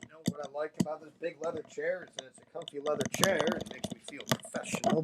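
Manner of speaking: fast hushed whisper; breathy secret whisper. Real, not acted. Sudden hushed chair commentary.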